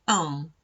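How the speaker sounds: un as the French nasal one; un said nasally. Shown as a demonstration. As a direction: model the nasal un. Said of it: "la pronunciamos".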